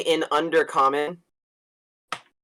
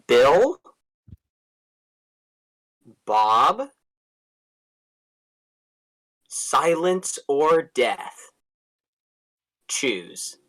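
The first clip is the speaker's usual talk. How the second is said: menacing dark elf; much slower than usual. Acted. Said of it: "I think I'm just gonna say".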